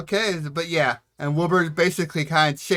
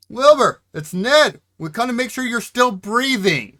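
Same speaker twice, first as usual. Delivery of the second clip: put-on loud, raised holler to an old man; calling out; 7 semitones higher, 9 dB louder than usual. Ned checking on old Wilbur through the door, calling out. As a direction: shout so he hears you through the door.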